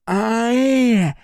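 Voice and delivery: muffled grunt through a gag; gagged. Acted. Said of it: "промычала Игураси сквозь кляп". (мычание сквозь кляп)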